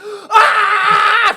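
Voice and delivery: long, high, very loud scream; mock agonized scream. Victor getting electrocuted, hammed-up scream. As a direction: scream like a hammy electrocution victim.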